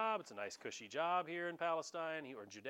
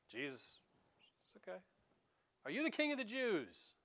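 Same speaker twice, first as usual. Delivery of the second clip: coaxing Pilate; 5 semitones higher than usual. Retold or imitated. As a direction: talk him down gently. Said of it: "Pilate's head".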